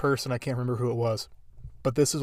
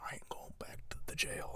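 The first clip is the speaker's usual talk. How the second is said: whispered, breathless thug line; mock whisper; 19 dB quieter than usual. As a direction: drop it to a whisper, still defiant. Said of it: "he's like".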